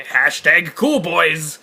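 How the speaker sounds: drawn-out mock-cool slogan. Co-host ridiculing hacker character designs, mocking. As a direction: stretch it out like a try-hard catchphrase.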